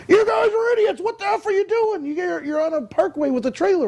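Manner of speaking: rough New York driver chewing them out; mock angry New Yorker yelling. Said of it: "he's cursing at us".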